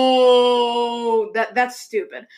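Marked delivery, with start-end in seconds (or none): 0.0-1.3 mock Vader wail, one long drawn-out cry; Darth Vader yelling. Imitating Vader at the ending of the movie, long mock yell. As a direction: stretch it into a long, theatrical yell. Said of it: "Darth Vader yelling".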